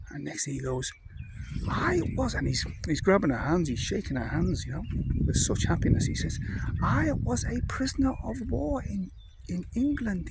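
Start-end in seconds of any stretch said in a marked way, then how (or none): 1.7-2.3 put-on high excited old-man voice; old German man. Old man begins his story. 6.8-10.3 put-on high, happy old-man voice; old German man. Old man telling the English lads of captivity, high happy voice.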